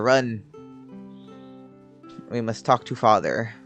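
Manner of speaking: ordinary delivery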